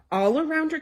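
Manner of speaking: plain reading voice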